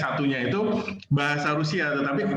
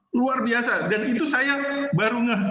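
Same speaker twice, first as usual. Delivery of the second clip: amazed; 8 semitones higher than usual. Real, not acted. Officer recalling the Russian's fluent Indonesian, amazed and lifted higher. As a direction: voice jumps higher in delight.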